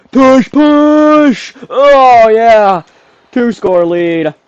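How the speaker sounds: high, loud celebratory shout; real triumphant yelling. Real, not acted. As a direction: yell them over the line, then celebrate. Cheering a goal-line push and score.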